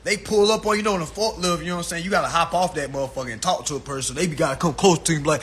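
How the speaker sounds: normal talking voice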